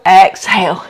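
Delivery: usual speaking voice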